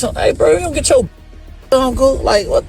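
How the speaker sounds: normal talking voice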